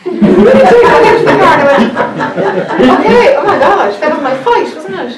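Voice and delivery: high, loud laughing through the words; laughing relief. Real, not acted. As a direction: let the release spill out as laughter. Laughing relief after the outburst.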